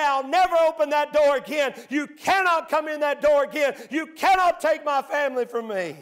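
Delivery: shouted, high declaration; defiant vow. Pretend. Parent vowing to keep sin out, defiant shout. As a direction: slam the door with your voice. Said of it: "shut it and say".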